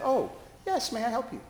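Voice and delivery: high, overly polite; composed chaplain politeness. Retold or imitated. Chaplain recovering her composure toward the glaring man, high polite. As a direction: sound professionally helpful. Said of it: "remembered my training".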